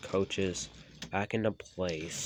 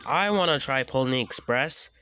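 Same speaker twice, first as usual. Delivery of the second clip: confident; 5 semitones higher, 6 dB louder than usual. Acted. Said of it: "said Flynn confidently".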